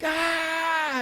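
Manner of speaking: high-pitched acted scream; mock scream. Man screaming in bed next to his wife, mock scream. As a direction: scream as if waking from a dream. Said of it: "he is screaming in bed".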